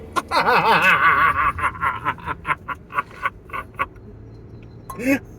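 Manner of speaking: long mock-evil laugh, slowly petering out; maniacal villain laugh. Driver alone performing for anyone watching, long maniacal villain laugh. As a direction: let the villain cackle run until breath fades. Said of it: "maniacal villain laughing".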